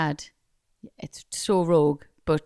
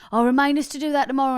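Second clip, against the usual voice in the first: mocking imitation; 7 semitones higher, 4 dB louder, faster than usual. Mocking partner's request.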